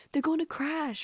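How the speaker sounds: high, loud cry of alarm; alarmed shriek. A narrator's reading. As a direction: shriek as a crash looms. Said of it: "Hermione shrieked".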